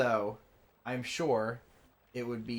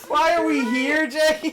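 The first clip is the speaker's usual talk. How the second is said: very high, loud squeal through laughter; real, breaking-up laughing wail; helpless laughter; 14 dB louder, slower than usual. Real, not acted. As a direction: wail the question while laughing to tears. Cracking up at their mistake.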